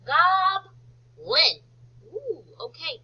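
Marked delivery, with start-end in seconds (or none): 0.0-1.6 GOBLIN split GOB... LIN, high and loud; teacher's slow two-part GOBLIN; syllable by syllable. Shown as a demonstration. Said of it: "What's the second syllable?".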